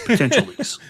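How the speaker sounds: laughing through the words; giggling out the phrase. Real, not acted. S1 cracks up repeating the joke.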